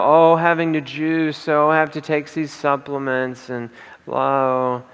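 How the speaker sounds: a slow, mock-whiny moan; whining complaint. Speaker mocking complaining patients, in a slow mock whine. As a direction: imitate a patient grumbling about the chores. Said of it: "They're grumbling, they're complaining".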